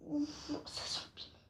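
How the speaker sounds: breathy whisper at the smell. Real, not acted. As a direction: whisper it, hit by the spice. Sniffing the hot pepper.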